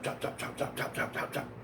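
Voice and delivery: rapid, whispered staccato chopping; soft percussive run of knife hits; imitated knife chops. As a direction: make quick, unvoiced chopping noises.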